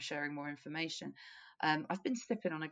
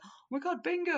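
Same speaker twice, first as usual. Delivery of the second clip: excited bingo shout; 11 semitones higher, 3 dB louder than usual. Retold or imitated. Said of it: "I was like".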